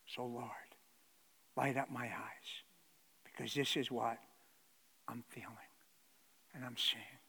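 soft, low whispered plea; whispered prayer. Retold or imitated. Preacher voicing the weary soul, whispered plea to God.

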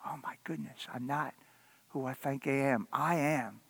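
very soft, hushed confession; broken whisper. Acted. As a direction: barely breathe the words out in shame. Peter's broken realization.

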